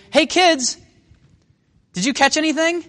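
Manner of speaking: high, loud hail from a distance; acted call across the water. Jesus hailing fishermen from the shore, calling out.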